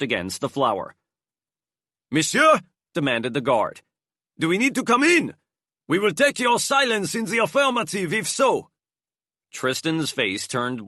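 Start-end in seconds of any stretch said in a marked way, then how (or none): 2.1-2.6 high, loud guard calling through the door; guard's demand. A narrator's reading. Guard demanding at the door, loud and high. 4.4-8.7 high voiced guard, firm threat; guard's warning. A narrator's reading. Guard warns they will enter, high and firm.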